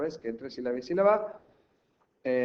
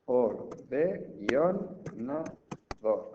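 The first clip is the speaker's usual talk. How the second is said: split into syllables; much slower than usual. Shown as a demonstration. Teacher showing pupils another possible split, syllable by syllable. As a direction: separate pieces with short pauses. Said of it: "Así también podría hacerlo".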